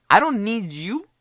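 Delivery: high, loud mock girl voice; dismissive girl. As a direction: imitate her brushing him off. Girl rejecting the guy, acted.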